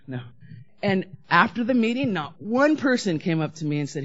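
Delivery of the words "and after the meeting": ordinary talk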